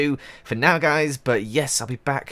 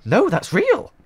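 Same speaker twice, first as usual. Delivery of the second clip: much higher, louder happy gasp of disbelief; delighted surprise; 13 semitones higher, 5 dB louder, slower than usual. Real, not acted. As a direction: shriek of joy at real diamonds. Finds real diamond after fake ones.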